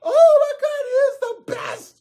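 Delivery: squealing falsetto fan frenzy; excited crowd. Acted. Everybody cheers DJ Troll's beatboxing, excited falsetto squeals. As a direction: gush very high, loud and fast. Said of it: "everybody was so excited".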